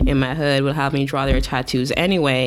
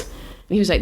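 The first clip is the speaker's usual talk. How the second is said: usual speaking voice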